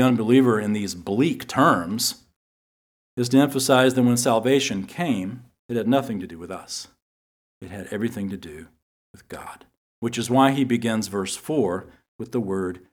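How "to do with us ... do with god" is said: dramatic whisper. Real, not acted. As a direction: drop to a hushed whisper for the key point. Sermon point dropped to whisper.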